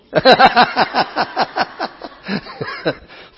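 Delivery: big loud burst of laughter; loud high laugh. Real, not acted. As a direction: howl with laughter. Reacting to the bishop.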